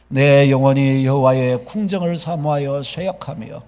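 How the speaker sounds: slow, solemn scripture reading together; 회중과 함께 시편을 천천히 낭독; psalm read aloud. Real, not acted. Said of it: "같이 한번 읽어보겠습니다".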